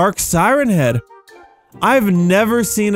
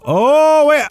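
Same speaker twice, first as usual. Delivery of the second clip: loud, high exclamation of alarm; sharp rise in surprise; startled; 11 semitones higher, 5 dB louder than usual. Real, not acted. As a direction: burst out as things suddenly go wrong. Something goes wrong in game.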